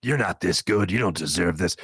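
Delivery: the inner monologue voice mocking his success, demonic and slow. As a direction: growl it like a demon whispering doubt. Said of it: "it's more demonic is what it is".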